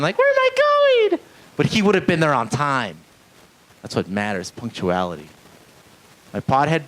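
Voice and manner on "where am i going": very high, loud hammed-up wail; mock crying girl. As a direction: imitate a captive girl crying out. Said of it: "girl tied up in his backseat crying".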